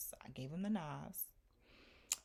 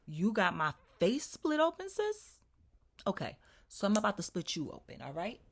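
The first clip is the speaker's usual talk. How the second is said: mock-outraged, higher and louder challenge; retold threat; 6 semitones higher, 8 dB louder than usual. Retelling her threat to the woman who cut her, indignant.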